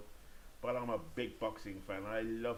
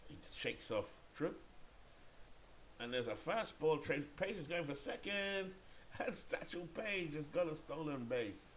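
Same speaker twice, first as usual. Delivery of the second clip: excited play-by-play call, pitched up; commentaire sportif; 6 semitones higher than usual. Pretend. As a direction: call the steal live, stretching the end. Live play-by-play of a stolen base.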